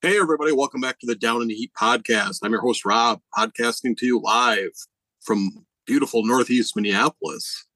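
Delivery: upbeat podcast opener; brighter intro. Real, not acted. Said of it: "you heard there".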